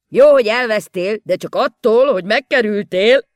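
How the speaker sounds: loud, high odd-speaking voice; Mikka Makka's voice. A narrator's reading. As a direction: blurt out the silly saying.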